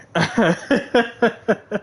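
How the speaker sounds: high chuckle; laugh. Real, not acted. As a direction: burst into high giggles. Laughs after her rant.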